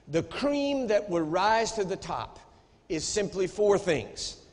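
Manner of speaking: normal talking voice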